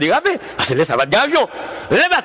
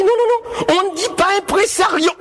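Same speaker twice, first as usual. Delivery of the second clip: pompous correction; 9 semitones higher than usual. Retold or imitated. Pompous suitor correcting Titine.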